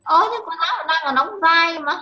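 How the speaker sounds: excited protest. Real, not acted. Child protests about role play.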